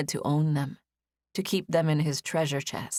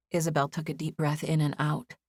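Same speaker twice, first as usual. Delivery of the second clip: ordinary delivery, nothing marked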